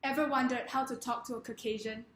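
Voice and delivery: ordinary talk, nothing marked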